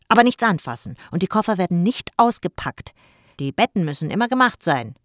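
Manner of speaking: loud calling after him. Acted. Jim calls his house rules after Jako, loud. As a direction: shout the rules down the hall. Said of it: "rief Jim hinter Jako her".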